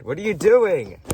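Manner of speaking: sudden loud what are you doing; loud surprised shout. Real, not acted. Friend acting up.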